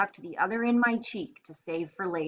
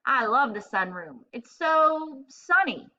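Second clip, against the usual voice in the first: slow, dopey dog voice; goofy dog; 5 semitones higher, 4 dB louder, slower than usual. A narrator's reading. Big goofy dog answering the hamster, dopey and slow. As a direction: drawl it like a happy, dim dog. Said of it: "Big goofy Buck said".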